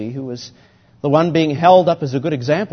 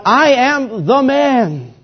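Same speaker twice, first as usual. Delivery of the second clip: anguished confession; 5 semitones higher, 4 dB louder than usual. Acted. Preacher speaking as the tax collector, anguished before God. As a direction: confess it as the desperate sinner. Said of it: "He's saying to God".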